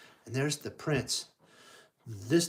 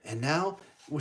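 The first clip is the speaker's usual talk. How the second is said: ordinary delivery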